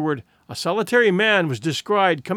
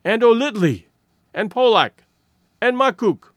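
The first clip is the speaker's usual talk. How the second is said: louder, higher calling of names; women crying out names, louder and higher; anxious cry; 5 semitones higher, 4 dB louder than usual. Acted. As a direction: call out each missing man, rising. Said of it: "The voices took up the cry".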